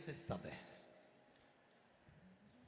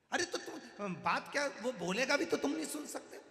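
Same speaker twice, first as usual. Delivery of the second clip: grandson exasperated; 11 semitones higher, 5 dB louder than usual. Acted. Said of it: "grandson argues with grandfather".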